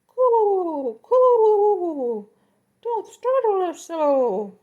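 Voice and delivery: very high, loud cooing, slow; flustered cooing birds; pigeon voices. Acted. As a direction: coo like fluttering pigeons. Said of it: "Now we're doing pigeons".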